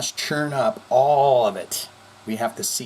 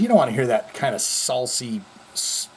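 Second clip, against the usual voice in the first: ordinary talk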